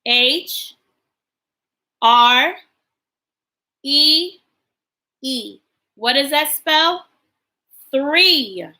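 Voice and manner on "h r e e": letter by letter, clear and paced; spelling aloud. Shown as a demonstration. Teacher spelling a sight word for the class, letter by letter. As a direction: spell it out slowly for little ones. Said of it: "will make the th sound".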